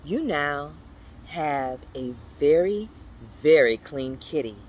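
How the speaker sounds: usual speaking voice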